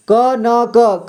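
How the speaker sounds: क न क, every syllable pronounced fully and apart; क न क, give each क its full sound; each syllable stressed. Shown as a demonstration. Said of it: "जोर देकर के उच्चारण किया जा रहा है". (हर अक्षर पर जोर देकर)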